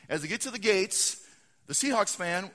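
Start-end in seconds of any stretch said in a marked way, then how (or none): none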